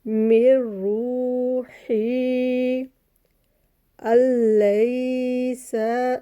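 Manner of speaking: very slow chanted recitation; tajweed recitation. Shown as a demonstration. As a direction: hold each vowel long as in Quran reading. Recited verse.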